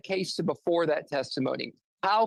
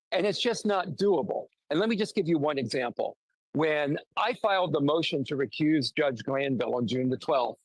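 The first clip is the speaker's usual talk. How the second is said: normal talking voice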